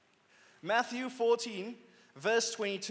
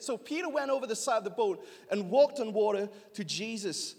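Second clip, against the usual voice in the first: ordinary talk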